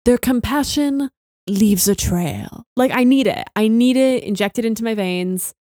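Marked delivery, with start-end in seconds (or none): 0.0-2.6 Grand Inquisitor line. Retold or imitated. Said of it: "It's just gotta be his lines".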